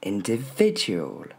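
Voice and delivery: INDIVIDUAL as "in-dé-VÉ-dju-ol", slowly; stress on VI. Shown as a demonstration. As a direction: lean on VI in "individual", fall away. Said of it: "il porte l'accent tonique".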